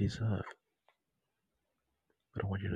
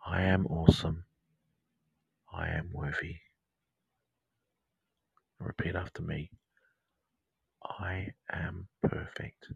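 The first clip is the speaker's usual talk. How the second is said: whispered meditation; 3 dB louder than usual. Acted. Meditation guide whispering mantras to listeners, hushed and intimate. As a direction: whisper the mantras right into their ear. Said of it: "sound like I'm whispering in your ear".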